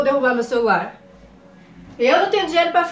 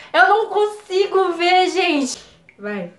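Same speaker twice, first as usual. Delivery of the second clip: normal talking voice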